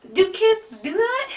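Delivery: high, slow, stunned question; asking in disbelief whether kids really bolt; incredulous disbelief. Retold or imitated. Recapping her shock at the toddler running, incredulous. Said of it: "I was like".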